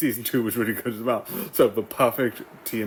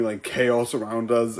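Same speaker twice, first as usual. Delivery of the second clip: usual speaking voice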